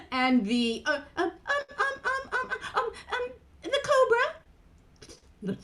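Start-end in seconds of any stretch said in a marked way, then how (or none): 1.8-4.3 high, hesitant puppet voice, then triumphant; Mimi. Acted. Mimi tries to remember the animals, high puppet voice. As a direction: fumble as a tiny character, then blurt it.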